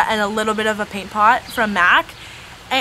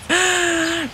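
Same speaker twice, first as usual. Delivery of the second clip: sharp gasp; 6 semitones higher than usual. Real, not acted. Sudden gasp at a mishap.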